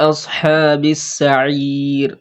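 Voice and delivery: very slow, drawn-out Quranic reading; slow recitation. Shown as a demonstration. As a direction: hold each sound of আসহাবিস সাঈর. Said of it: "সাকিনের কাজ করে থামবো". (আসহাবিস সাঈর ধীরে টেনে)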